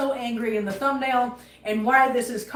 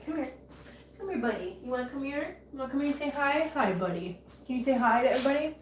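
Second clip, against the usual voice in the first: coaxing pet voice; 6 dB quieter, faster than usual. Real, not acted. Owner softly coaxing her cat to come say hi. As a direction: coax the cat over gently.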